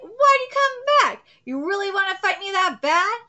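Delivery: high, loud challenge; taunting villain. Acted. Villain asking the returning hero about a fight, taunting. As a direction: read it as a villain's incredulous taunt.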